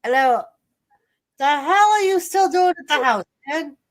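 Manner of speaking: usual speaking voice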